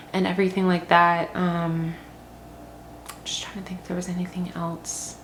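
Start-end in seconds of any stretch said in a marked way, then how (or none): 3.2-5.1 near-whisper, musing to oneself; barely voiced aside to yourself; quiet thinking aloud. Real, not acted. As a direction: murmur it softly while searching your memory.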